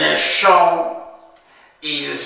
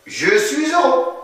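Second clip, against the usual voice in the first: acted happiness; 9 semitones higher than usual. Shown as a demonstration. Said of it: "de la tête aux pieds".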